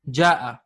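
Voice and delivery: "ja'a" dito devagar, com as vogais longas. Shown as a demonstration. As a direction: pronuncie "ja'a" claramente, como modelo. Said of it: "The fi'l is".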